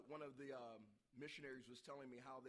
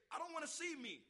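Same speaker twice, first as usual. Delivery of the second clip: high, loud protesting voice; hammed-up high-pitched refusal; 13 semitones higher, 8 dB louder than usual. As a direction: whine in dismay at your own reflection.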